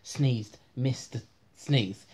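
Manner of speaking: ordinary delivery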